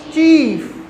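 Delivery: चीफ slowly, with the ee held; long stressed ee. Shown as a demonstration. Teacher showing the written word, चीफ with a long vowel. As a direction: stretch चीफ as "cheee-f". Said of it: "stress दे के बोलना है".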